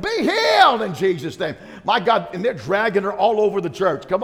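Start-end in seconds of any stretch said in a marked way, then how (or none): 0.0-1.6 loud, high healing shout; screaming. Retold or imitated. Pastor's wife screaming healing as ushers drag the lady. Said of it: "She's screaming at the top of it".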